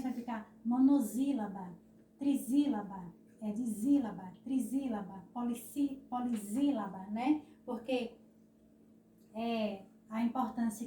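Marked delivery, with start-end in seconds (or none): 0.6-1.7 monossílaba with a Z, mo-no-ZÍ-la-ba. Shown as a demonstration. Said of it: "coloque só um S". 2.2-5.2 trisílaba, dissílaba as tri-ZÍ-la-ba, di-ZÍ-la-ba. Shown as a demonstration. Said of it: "coloque só um S". 6.1-7.1 polisílaba as po-li-ZÍ-la-ba. Shown as a demonstration. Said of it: "um S entre duas vogais tem som de Z".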